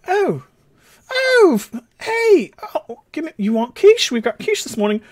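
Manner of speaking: high-pitched flustered hostess; halting high voice, offering food; Gwen. Acted. Gwen offering quiche this morning, high and flustered. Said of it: "Gwen's like".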